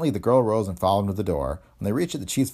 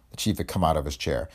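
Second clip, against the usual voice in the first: plain reading voice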